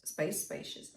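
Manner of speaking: whispered. Shown as a demonstration. Coach whispers the word spacious to show open space.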